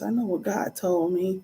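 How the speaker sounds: ordinary talk, nothing marked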